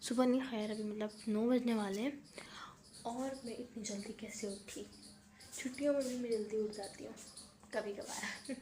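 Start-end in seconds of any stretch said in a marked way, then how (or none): 3.1-4.9 hushed whisper so nobody wakes. Real, not acted. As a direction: breathe it out without voice, family asleep. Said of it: "मैं आहिस्ता क्यों बोल रही हूँ". (फुसफुसाकर)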